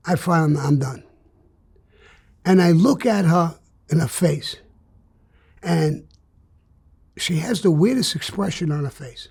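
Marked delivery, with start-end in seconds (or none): none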